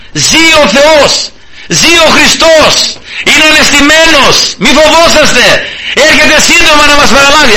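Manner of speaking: preacher crying out the good news to the world; proclaiming. Acted. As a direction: cry it out like a herald, stretching each word. Preacher proclaims the gospel cry.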